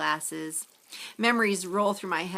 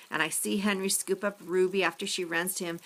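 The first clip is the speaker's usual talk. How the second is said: ordinary delivery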